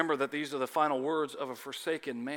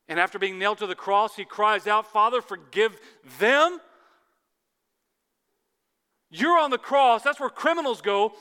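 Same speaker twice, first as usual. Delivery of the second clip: loud, much higher preaching cry; fervent cry; 5 semitones higher, 10 dB louder than usual. Acted. Preacher voicing Jesus on the cross, in a loud fervent cry.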